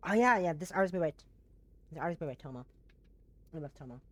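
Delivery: usual speaking voice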